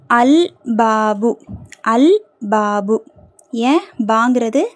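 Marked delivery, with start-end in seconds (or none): 0.1-3.0 slow "அல் பாபு" with a clearly held L; teacher's slow model of "அல் பாபு", L fully heard; lam pronounced. Shown as a demonstration. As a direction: sound the L in "அல் பாபு", drawn out for learners. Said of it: "கமரியாவுடைய எழுத்து".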